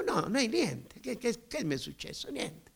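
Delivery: high, soft, stammered brush-off; evasive denial. Retold or imitated. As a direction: play innocent, deny it in a small voice. Acted evasive denial to his sister.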